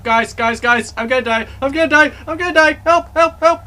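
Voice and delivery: loud, high panicked cries for help; panicked screaming. Real, not acted. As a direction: scream like you're being chased. Chased by monster in game.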